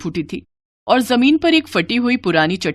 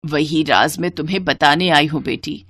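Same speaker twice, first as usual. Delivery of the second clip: lower, measured motherly voice; mother's spirit; 4 semitones lower than usual. Acted. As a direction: reveal a secret gently to your daughter. (नीची आवाज़ में राज़ बताती माँ)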